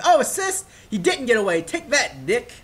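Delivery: high, loud burst of mock triumph; hammed-up victory crow; excited gloating. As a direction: act out sudden glee at an unexpected win. Acting out joy at assist.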